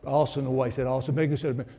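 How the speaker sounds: normal talking voice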